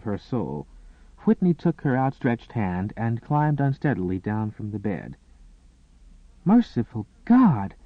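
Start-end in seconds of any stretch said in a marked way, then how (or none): 6.4-7.7 higher, louder gasped exclamation of shock. Acted. Maid seeing bloodstained sheets, gasping in shock. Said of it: "Mary gasped".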